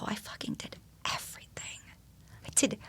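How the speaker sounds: hushed, intense whisper of triumph; fierce whisper. Retold or imitated. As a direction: breathe it out like a secret victory. Imagined final words at death.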